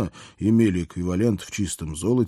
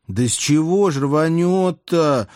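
high, louder, drawn-out objection; balky protest; 8 semitones higher, 5 dB louder, slower than usual. A narrator's reading. Princess doubts it will explode, balking in a high voice. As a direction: dig in and protest, stretching the words. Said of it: "было заортачилась она".

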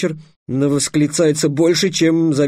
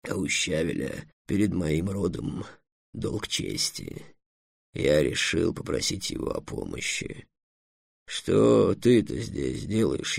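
low, slow, soft dragon voice; dragon Эллен, grave; 5 semitones lower, 7 dB quieter than usual. Acted. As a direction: speak gravely and unhurriedly, very quietly. (низкий, тихий, медленный голос дракона)

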